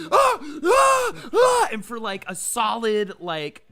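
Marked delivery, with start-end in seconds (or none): 0.1-1.7 repeated loud, high panicked screams; screaming. Retold or imitated. Waking up beside screaming girlfriend, panicked screaming. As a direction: scream in short, terrified bursts. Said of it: "Lexi and I are literally just screaming".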